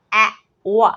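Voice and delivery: a and o sounded apart, "ए, ओ"; wrong separate letters. Shown as a demonstration. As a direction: split "ए, ओ" into two separate vowels, the wrong way. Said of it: "नहीं, ये हो जाएगा गलत".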